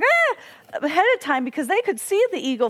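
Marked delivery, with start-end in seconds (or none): 0.0-0.4 high imitated loon call; loon cry. Explaining the loon's warning, a high imitated cry. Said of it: "the one loon was going".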